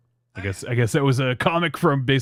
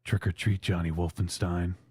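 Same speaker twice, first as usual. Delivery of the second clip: creepy voice; 5 semitones lower, 5 dB quieter than usual. Acted. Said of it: "Creepy voice".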